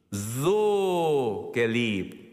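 "So" very slow and stretched; stress on so. Shown as a demonstration. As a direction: dwell on "So" before "geliebt". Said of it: "die Betonung liegt auf so im Griechisch". ("So" ganz langsam und gedehnt betont)

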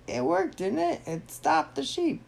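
very high squeaky character voice; Turn Ninja. Acted. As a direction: defend yourself in a high cartoon voice. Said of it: "And Turn Ninja's like".

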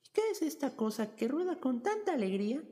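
high, wondering princess; cartoon princess. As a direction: marvel like a curious storybook princess. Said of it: "preguntó la muchacha".

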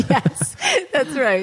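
helpless laughter while agreeing; laughing. Real, not acted. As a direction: agree slowly through real laughter. Amused by guest's joke about double.

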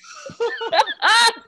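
high-pitched cackling laugh; real laughter. Real, not acted. Teacher laughs at feeling old, high real laughter. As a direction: crack up at your own story.

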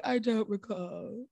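slow, drawn-out mimicked quote; lightly acted TV character line; imitated character. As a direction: quote him slowly, in a put-on voice. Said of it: "Yeah, he was like".

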